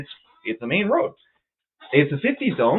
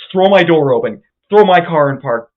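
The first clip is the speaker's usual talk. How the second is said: normal talking voice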